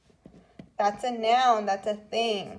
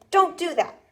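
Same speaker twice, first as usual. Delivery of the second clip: Don't do that given as a firm, higher command; demand example; 7 semitones higher, 5 dB louder than usual. Shown as a demonstration. Teacher modelling a demand for students, firm and raised. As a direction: sound like you're telling someone off. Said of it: "you could say".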